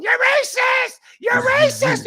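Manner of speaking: high shrill-pitched mock scream of a protester. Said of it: "screaming into the megaphone".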